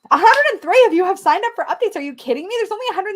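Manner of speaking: high and loud with a laugh, thrilled by the number; can't believe how many signed up; laughing amazement; excited disbelief. Real, not acted. Thrilled at the signup count.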